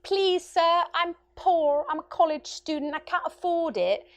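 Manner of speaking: high, loud whiny begging; hammed-up poor-student plea; mock pleading. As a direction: beg the conductor for pity, overdone. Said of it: "plead my case".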